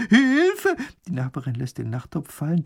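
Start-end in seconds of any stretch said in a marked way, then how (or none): 0.1-0.8 very high, loud squeal; panicked cry for help. Acted. Neighbour about to run from a dragon, panicked. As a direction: shriek for help in mock-earnest terror.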